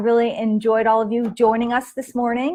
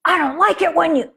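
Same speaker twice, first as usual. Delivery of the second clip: child imitation; 6 semitones higher, 3 dB louder than usual. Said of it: "match your voice to mine".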